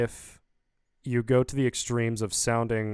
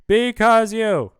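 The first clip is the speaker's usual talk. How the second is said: a huge slow shout, much higher and louder; screamed, dragged-out yell; 10 semitones higher, 10 dB louder, much slower than usual. Shown as a demonstration. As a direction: bellow it out, stretched long. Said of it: "all of these are just me shouting".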